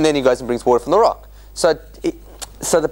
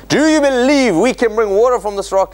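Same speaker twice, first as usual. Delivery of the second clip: evangelical preacher; 8 semitones higher than usual. Pretend. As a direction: rouse the crowd like a tent preacher. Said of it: "like an evangelical preacher".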